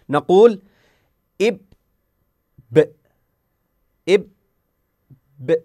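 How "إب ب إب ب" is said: إب then ب with a clear echo as the lips open; bounced b sound. Shown as a demonstration. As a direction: release the ب with an open bounce, not pressed. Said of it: "لاحظوا جيدًا تطبيق حرف الباء". (قلقلة الباء بانفتاح)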